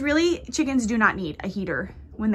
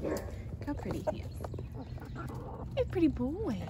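tender pet talk, quieter; cooing; 4 semitones higher, 8 dB quieter than usual. Real, not acted. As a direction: coo at him softly. Cooing at the rooster.

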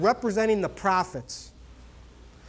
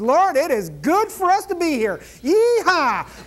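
excited Peter; 7 semitones higher, 5 dB louder than usual. Acted.

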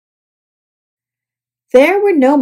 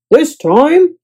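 loud, higher declaration; declaring; 5 semitones higher, 6 dB louder than usual. A narrator's reading. Guest to bellhop, declaring. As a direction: announce it firmly. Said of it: "declared Mister Snore".

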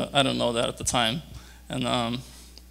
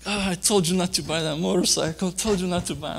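higher-pitched imitation of an exasperated mother; scolding mom; 6 semitones higher than usual. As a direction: do a mother's upset I-told-you-so. Mother scolding her injured son.

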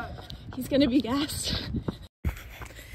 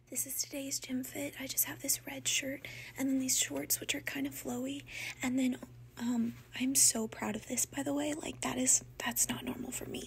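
whispering; 3 semitones lower, 11 dB quieter than usual. Real, not acted. Whispered gym outfit rundown.

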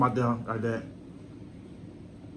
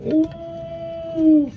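squealed high ooh of delight; 14 semitones higher than usual. Real, not acted. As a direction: coo at it in a high voice. Admiring the food.

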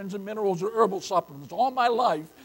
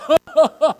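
loud, high laugh breaking out; burst of laughter; 6 semitones higher, 10 dB louder than usual. Real, not acted. Preacher laughing as he explains laughing's benefits. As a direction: let a real laugh burst through.

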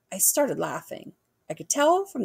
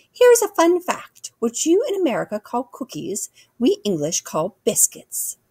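high queenly voice, easing down and slowing; regal queen, instructive; 5 semitones higher, 4 dB louder than usual. Acted. Queen teaching an American about biscuits, regal and instructive. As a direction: explain it grandly like a monarch.

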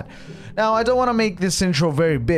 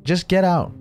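annoyed. Acted. Acted quarrel with the alter ego.

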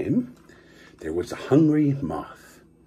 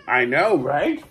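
ordinary delivery, nothing marked

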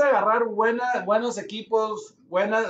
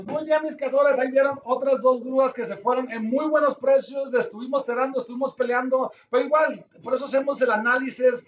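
upbeat, higher host voice; vlog host wrap-up; 4 semitones higher than usual. Acted. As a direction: sign off to viewers with energy. Host addresses viewers, wraps up.

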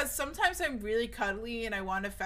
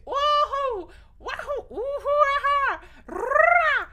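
excited yelps; 14 semitones higher, 7 dB louder, much slower than usual. Real, not acted. Nervous outburst on stream.

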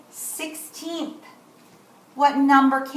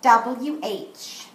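ordinary talk, nothing marked

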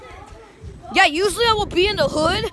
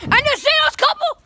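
voice crack; 9 semitones higher than usual. Real, not acted. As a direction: voice cracking up high. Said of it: "that was a voice crack".

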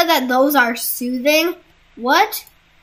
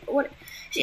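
ordinary talk, nothing marked

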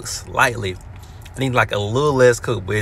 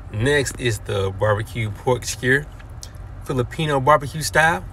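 ordinary delivery

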